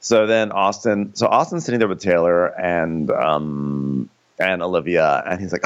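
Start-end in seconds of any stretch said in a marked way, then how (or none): none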